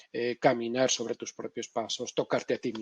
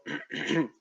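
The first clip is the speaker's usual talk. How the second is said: a quick rough throat clear; demo throat clearing; 2 semitones higher than usual. Shown as a demonstration. Lecturer demonstrating a phonic tic, throat clear. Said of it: "Tics fónicos como carraspeo".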